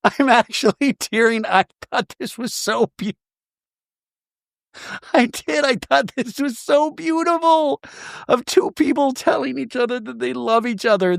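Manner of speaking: really moved and welling up as she speaks; high emotional voice, fighting back tears; tearing up. Real, not acted. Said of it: "I'm tearing up".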